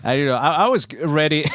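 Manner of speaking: loud, high, eager outburst; sudden eager exclamation; eager burst. Retold or imitated. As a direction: blurt it out all keyed up. Excitedly recalls being ready to drink.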